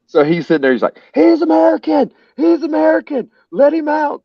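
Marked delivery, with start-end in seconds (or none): 1.1-4.2 very high-pitched hollering on someone's behalf; imitating a friend yelling over; retold shouting. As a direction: call it out high and insistent to the cops.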